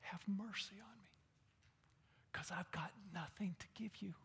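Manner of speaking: hushed, broken whisper; whispered plea. Acted. Blind beggar whispers his plea to Jesus.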